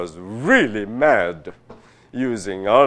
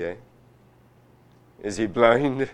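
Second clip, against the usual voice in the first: normal talking voice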